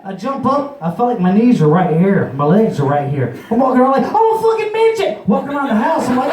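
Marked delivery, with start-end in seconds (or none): 4.0-5.3 very high hammed-up freakout; mock panic. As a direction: squeal it in comic alarm. Acts tripping panic.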